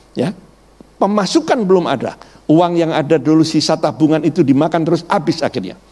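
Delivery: normal talking voice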